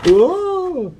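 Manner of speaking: sudden high yelp of fright; shocked cry in the dark; startled gasp. Acted. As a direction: jump as you say it. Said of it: "Harry leapt into the air".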